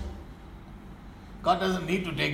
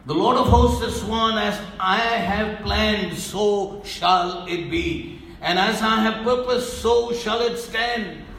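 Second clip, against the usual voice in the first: proclaiming scripture; 3 semitones higher, 4 dB louder than usual. Acted. Preacher reading Isaiah's oath aloud to the congregation, proclaiming loudly.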